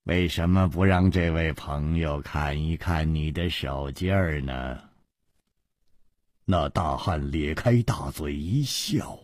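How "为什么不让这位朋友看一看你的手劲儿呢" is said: low, soft, sinister prompting; quiet menace. Acted. Villain shows off his henchman, quiet menace. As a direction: coax softly with a threat underneath.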